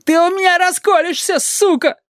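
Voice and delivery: very high, threatening interrogator; acted cop losing his temper; angry yelling. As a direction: threaten the witness in a high-pitched fury. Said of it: "орал на неё блондин".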